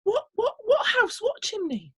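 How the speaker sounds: high, stammering confusion; bewildered farmer. Acted. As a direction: fumble for words, baffled. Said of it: "said the farmer".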